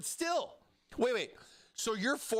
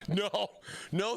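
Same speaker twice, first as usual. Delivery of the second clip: normal talking voice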